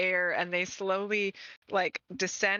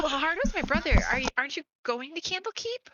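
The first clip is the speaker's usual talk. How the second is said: higher-pitched fan voice; puzzled fan; 8 semitones higher, much faster than usual. Acted. Fan questions the band about visiting Candlekeep, puzzled. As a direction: ask as the puzzled sister.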